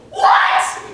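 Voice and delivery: sudden falsetto yelp, way up high; high-voiced patient's shocked squeak; high-voiced man. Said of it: "he has this unnaturally high voice".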